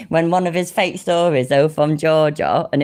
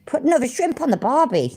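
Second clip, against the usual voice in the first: higher, badly faked Australian accent; awful Australian accent; 7 semitones higher than usual. Shown as a demonstration. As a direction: attempt a stock Australian accent. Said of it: "No, that was awful".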